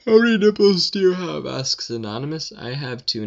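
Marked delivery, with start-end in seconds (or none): 0.1-1.5 put-on voice. Reading question in put-on voice.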